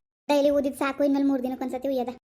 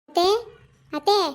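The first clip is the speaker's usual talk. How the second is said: louder, higher call with rising end; 3 semitones higher, 4 dB louder than usual. Acted. Daughter-in-law calling to her mother-in-law, calling out. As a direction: call for someone in the house.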